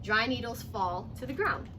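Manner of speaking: clear, lively read-aloud voice; storybook narration for young kids; reading to children. Acted. Teacher reading picture book aloud.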